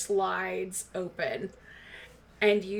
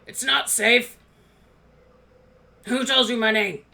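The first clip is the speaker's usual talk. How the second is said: gruff dwarf; 6 dB louder than usual. Acted. Dwarf NPC gruff through door.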